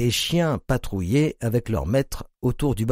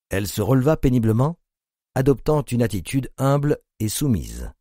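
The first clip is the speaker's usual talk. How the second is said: ordinary delivery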